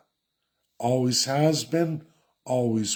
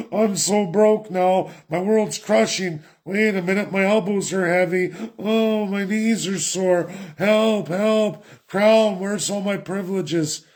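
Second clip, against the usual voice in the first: higher-pitched mock whine, slowed down; whiny mock Canadian; 6 semitones higher than usual. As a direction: whimper like a spoiled citizen begging the crown. Mock whining Canadians quoted.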